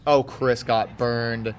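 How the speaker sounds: normal talking voice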